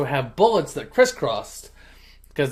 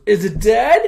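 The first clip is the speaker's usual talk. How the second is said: mock shock; 6 semitones higher, 6 dB louder than usual. Rocco after shooting the cat.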